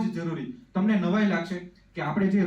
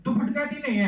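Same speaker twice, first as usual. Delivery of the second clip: higher, imitating a father calling out a warning; father's warning; 6 semitones higher than usual. Imitating dad warning the little girl near the sofa. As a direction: sing out a mock fatherly caution. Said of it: "મારા પપ્પાના મોઢામાંથી એવો અવાજ નીકળ્યો".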